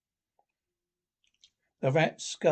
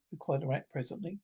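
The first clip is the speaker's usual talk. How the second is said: ordinary delivery, nothing marked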